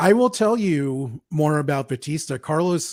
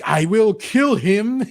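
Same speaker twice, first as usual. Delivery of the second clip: slow, pitched-up hammed-up yell of a threat; mock screaming threat; 7 semitones higher, 4 dB louder, slower than usual. Podcaster imitating Sting's cheesy duel scream, hammed-up. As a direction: play at screaming it like a cheesy villain. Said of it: "Doesn't he keep screaming".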